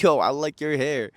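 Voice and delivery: put-on "Yo, I like your hair." in a slow, lightly done New York accent. Vlogger replaying his New York accent line to the girl. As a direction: lean into a New York street greeting on "Yo". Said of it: "said that full-blown New York accent".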